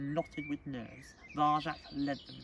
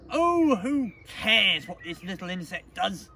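high, loud, drawn-out scorn; scornful sneer; 6 semitones higher, 12 dB louder, slower than usual. Acted. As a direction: sneer it slowly and loudly. Cousin sneers at the young kitten.

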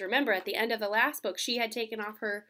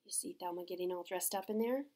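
ordinary delivery, nothing marked

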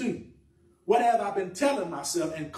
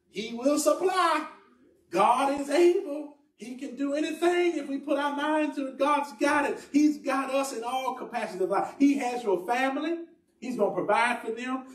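impassioned preaching; 8 semitones higher than usual. Real, not acted. Preacher reminding congregation of God's plan, impassioned. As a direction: preach it high, stretching each phrase.

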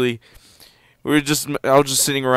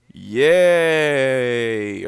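drawn-out cheer. Real, not acted. Cheers on getting the star.

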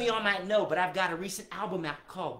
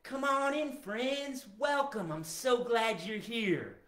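higher, drawn-out friendly greeting; welcoming; 4 semitones higher, slower than usual. Shown as a demonstration. Owner showing his dog how to welcome friends, warm call. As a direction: sing it out as an open invitation. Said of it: "You can say".

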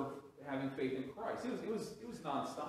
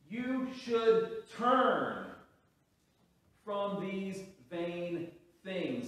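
slow, loud, higher call to repent; preaching; 5 semitones higher, 5 dB louder, much slower than usual. Acted. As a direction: stretch each word like a plea from the pulpit.

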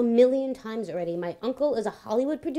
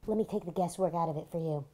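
ordinary talk

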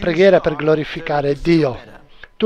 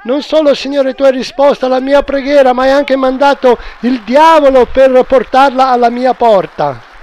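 higher, louder, fast rejoicing; joyful shout; 7 semitones higher, 6 dB louder than usual. Acted. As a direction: shout alleluia in triumph. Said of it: "E lei gridò".

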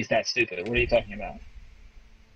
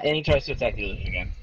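usual speaking voice

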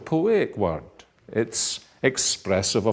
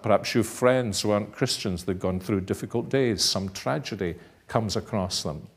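ordinary talk

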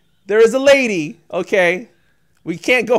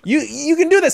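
ordinary delivery

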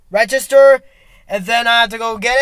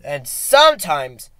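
mock deep musing; 6 semitones lower, 3 dB quieter than usual.

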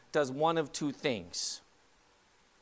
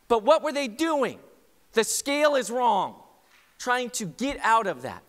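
raised pitch, forceful preacher; emphatic preaching; 7 semitones higher, 6 dB louder than usual. Real, not acted. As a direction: drive the point home to the congregation.

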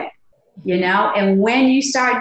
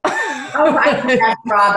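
laughing; 3 semitones higher, much slower than usual. Real, not acted. Laughs recognizing Rob.

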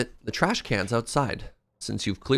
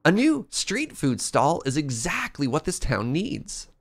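higher, bright encouraging daughter; lightly voiced cheerful daughter; 7 semitones higher than usual. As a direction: lift the pitch for the daughter, eager.

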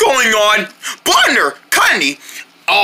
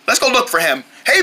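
ordinary delivery

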